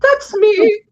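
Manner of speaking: high squeal of disbelief; shocked excited cry. Real, not acted. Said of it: "Oh my God, you have got to be kidding me".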